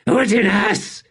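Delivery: desperate emperor gasping out the slave's name. Acted. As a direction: call out as if drowning in fear. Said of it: "A desperate gasp".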